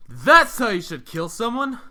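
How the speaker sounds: high, loud astonished outburst; amazed exclaim. Acted. Teammate stunned after the grenade wipes out enemies. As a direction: blurt it out in disbelief.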